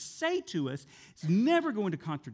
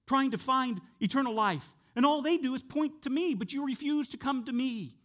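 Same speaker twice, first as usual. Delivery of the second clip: raised, high-pitched rebuke; criticizing; 8 semitones higher, 4 dB louder than usual. Acted. Jesus criticizing teachers of the law, high insistent reproach. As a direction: press the accusation hard, voice pitched up. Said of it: "he's gonna criticize some Pharisees".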